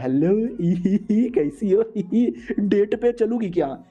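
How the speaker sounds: giggles between the words, pitch a little up; a hammed-up lovestruck giggle; giggly flirting. Elder giggling as he asks the woman on a date.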